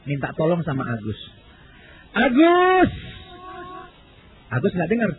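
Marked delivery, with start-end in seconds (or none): 2.1-2.9 high call to a friend below; shouting down. Retold or imitated. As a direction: yell his name down three floors. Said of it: "Dia teriak ke bawah".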